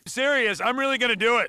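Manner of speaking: high, loud, eager insistence; excited insistence. Acted. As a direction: insist you truly mean it. Insisting they will do it.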